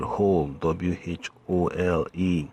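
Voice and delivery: "whole" said slowly, then spelled out letter by letter; emphasis on whole. Shown as a demonstration. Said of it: "More emphasis on that word".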